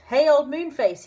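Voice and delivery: loud high call through the door; shouting to Moonface through the shut door; shouted. A narrator's reading. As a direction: shout it up at him. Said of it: "he shouted".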